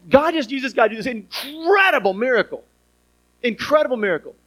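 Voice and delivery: high, emphatic wonder at the miracle; hammed-up amazement at the miracle; amazed preaching. Real, not acted. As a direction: marvel out loud.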